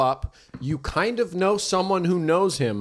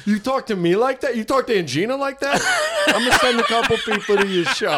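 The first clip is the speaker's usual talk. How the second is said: higher mock-aunt threat through laughter; 9 semitones higher, 4 dB louder than usual. Friend plays the aunt threatening revenge, laughing. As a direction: play the petty aunt, laughing.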